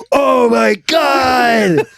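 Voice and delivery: loud, slow, drawn-out mock shock; shocked shout. Friends watching the video flip, loud drawn-out shock. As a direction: cry it out in disbelief. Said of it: "we were like".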